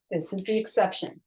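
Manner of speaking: ordinary talk, nothing marked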